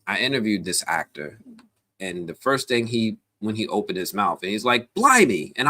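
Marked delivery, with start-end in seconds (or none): 4.9-5.5 mock British exclamation, pitched high; a quick put-on English "Blimey"; British accent. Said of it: "You're British?".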